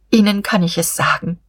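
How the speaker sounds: ordinary delivery, nothing marked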